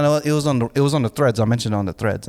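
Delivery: ordinary talk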